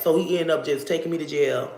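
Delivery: ordinary talk, nothing marked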